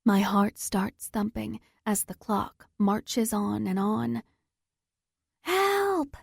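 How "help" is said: high cry for help, voice tiring; worn-out scream. Acted. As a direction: call out though your throat is failing. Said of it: "My voice is getting weaker".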